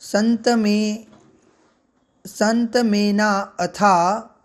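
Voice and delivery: very slow, drawn-out संत में ना अथा; slow syllable by syllable. Shown as a demonstration. Teacher slowly sounding out a hard phrase for students, drawn out. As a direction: linger on each syllable. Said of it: "इस मंत्र को हम शनै शनै उच्चारित करते हैं".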